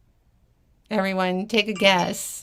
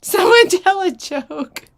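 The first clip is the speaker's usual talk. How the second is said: flustered laughing; 9 semitones higher, 7 dB louder, slower than usual. Real, not acted. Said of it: "I'm dying".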